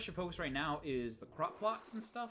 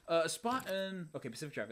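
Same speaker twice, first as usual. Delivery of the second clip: ordinary delivery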